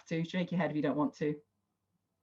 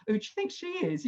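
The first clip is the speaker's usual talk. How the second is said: mocking, much higher, scornful aside; eye-rolling sarcasm; 11 semitones higher, much faster than usual. Group members mocking a bragging statement, sarcastic high eye-roll. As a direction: sneer it like a skeptic rolling their eyes. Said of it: "None of us were rolling our eyes going".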